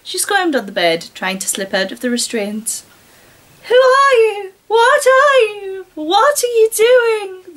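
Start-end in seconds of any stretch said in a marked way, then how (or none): 3.7-7.6 high, loud frightened shout, slowing down; panicked yell. A narrator's reading. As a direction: scream it at your captor. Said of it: "the girl yelled".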